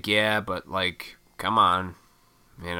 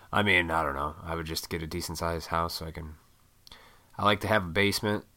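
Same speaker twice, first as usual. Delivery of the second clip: ordinary talk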